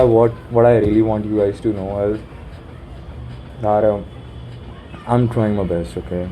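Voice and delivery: normal talking voice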